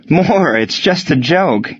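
slow, breathless after laughing; out of breath from laughing. A narrator's reading. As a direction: gasp it out, laughed out. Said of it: "after catching his breath".

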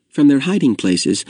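plain reading voice

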